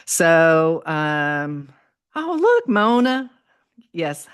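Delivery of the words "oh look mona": light up as you spot a friend; delighted surprise. Real, not acted. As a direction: pitch jumps high on oh, look. Spots a friend's comment live.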